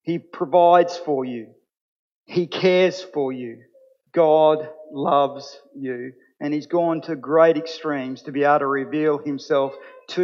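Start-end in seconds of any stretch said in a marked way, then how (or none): 4.1-6.1 "God loves you" drawn out very slowly, "God" stressed; deliberate, weighty proclamation; slow emphasis. Shown as a demonstration. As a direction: let each word land, unhurried. Repeated refrain in sermon.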